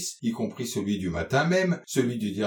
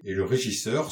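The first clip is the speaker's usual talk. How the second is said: ordinary delivery, nothing marked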